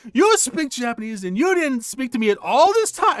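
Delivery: high, loud, accusing reproach; angry outburst. Retold or imitated. Girl scolding him for never speaking to her.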